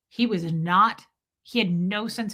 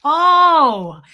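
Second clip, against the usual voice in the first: chuckle, then wail it in delight; big drawn-out cry of pleasure; delighted laughing groan; 6 semitones higher, 11 dB louder than usual. Real, not acted. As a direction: laugh swelling into a loud, high "oh". Laughing delight at her find.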